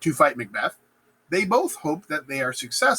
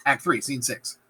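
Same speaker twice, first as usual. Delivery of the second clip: usual speaking voice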